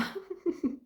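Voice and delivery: high, light little laugh; a quick amused laugh on a high pitch; short giggle. Real, not acted. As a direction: giggle at yourself. Laughs at her own answer.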